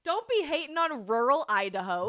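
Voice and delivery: loud, playful mock protest; mock offended. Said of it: "Just kidding".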